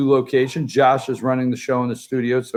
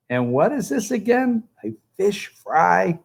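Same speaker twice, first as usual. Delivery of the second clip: mock Southern accent, higher-pitched puzzled question; put-on Southern accent; 5 semitones higher, 3 dB quieter than usual. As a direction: imitate a politician trying out a Southern accent. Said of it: "practicing their Southern accent".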